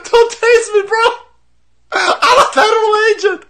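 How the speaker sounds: very high, whiny mock plea. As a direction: whimper it like a baby. Mocking the tased agent.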